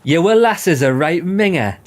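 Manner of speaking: high, loud Mackem impression; Sunderland accent. Shown as a demonstration. Player imitating local talk about a lass, exaggerated Sunderland accent. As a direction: put on a broad Sunderland accent. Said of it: "It's like Sunderland accent".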